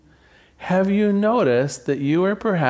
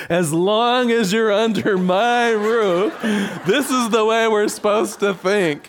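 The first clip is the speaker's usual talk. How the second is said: strict parent; 6 semitones higher than usual. Retold or imitated.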